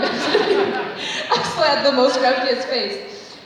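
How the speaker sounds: laughing through it, slow; real amused laugh. Real, not acted. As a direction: giggle while admitting it. Laughing at herself.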